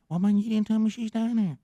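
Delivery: high, whiny imitation of a panicky friend; imitating scared friend. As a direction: squeak it out like a spooked buddy caught off guard. Friend voiced whining in a story.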